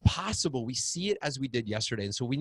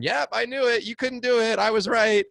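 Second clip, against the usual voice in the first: higher, louder mock smugness; smug dad; 11 semitones higher, 7 dB louder than usual. Dad gloating his son couldn't do it, mock smug. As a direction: gloat that you were right. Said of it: "the dad going".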